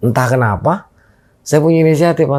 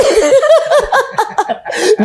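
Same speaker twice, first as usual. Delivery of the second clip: real high-pitched laugh; tertawa geli. Real, not acted. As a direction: burst out laughing mid-story. Cracks up while retelling. (tawa geli bernada tinggi)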